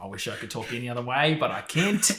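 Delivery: hammed-up Australian lament; mock Australian accent. Speaking as Australians who hate how they sound, mock Australian accent. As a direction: complain in a put-on Australian accent. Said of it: "Even Australians, like, kinda just hate".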